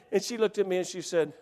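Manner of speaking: ordinary talk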